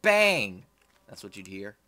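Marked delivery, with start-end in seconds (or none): 0.0-0.6 high, loud vocal bang; gunshot sound. Pretend. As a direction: shout it like a gunshot. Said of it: "Every time the gun goes off".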